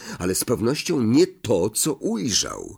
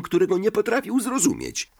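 usual speaking voice

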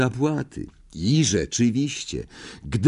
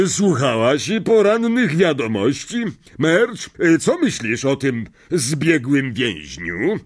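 higher, hasty uncle; uncle changing subject; 6 semitones higher than usual. A narrator's reading. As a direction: steer talk away nervously. (wujek szybko zmienia temat)

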